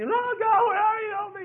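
very high, loud mock wail; hammed-up desperate plea; blubbering cry. David crying out to God, exaggerated blubbering wail. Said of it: "start crying out to God and blubbering again".

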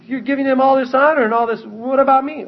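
high-pitched, loud wounded complaint; mock indignant protest. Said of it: "and you say".

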